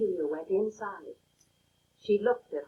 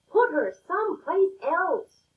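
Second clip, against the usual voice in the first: raised, higher angry shout; 7 semitones higher, 4 dB louder than usual. A narrator's reading. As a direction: yell it at the coach across the field. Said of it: "they shouted".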